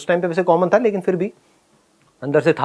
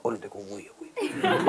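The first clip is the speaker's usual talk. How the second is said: hushed, much softer pointing-out; whispering kids; 6 semitones lower, 14 dB quieter, slower than usual. Retold or imitated. Schoolmates whispering about the new hero, hushed. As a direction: murmur it behind his back.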